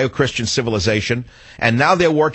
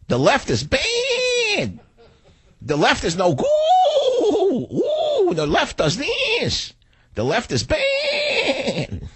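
very high, slow, whiny squeal with a shouting edge; drawn-out piggish wail of a squealing talk host; mock squealing conservative; much slower than usual. Host mocking conservative talk hosts as stuck pigs, high squeal. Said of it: "They're squealing like stuck pigs".

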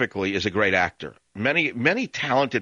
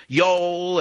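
high, drawn-out mock Southern; fake Southern accent; 8 semitones higher than usual. Host mimicking New York girls playing Southern, mock Southern accent. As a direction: parody a bad attempt at a Southern accent. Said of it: "they think that passes for Southern accents".

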